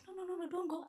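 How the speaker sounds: high, pleading no no no; mock pleading. Lady begging the consultant not to leave, high mock plea. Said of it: "So she's like".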